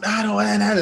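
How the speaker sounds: put-on high sulky child voice; whiny kids. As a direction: mimic kids whining. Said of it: "my kids were completely just going".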